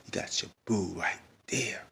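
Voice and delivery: slow whispered echo. Pretend. Vlogger teasing Bobby under his breath, whispered. Whispered repeat of own quote.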